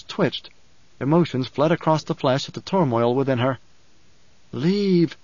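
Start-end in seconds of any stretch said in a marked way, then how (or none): none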